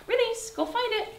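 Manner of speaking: high bright release and go; high release cue. Real, not acted. Trainer releasing her dog to find a treat. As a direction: lift it up to free her.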